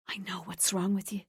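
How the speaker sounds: soft unvoiced whisper. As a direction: whisper it close in her ear. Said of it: "Tara whispered".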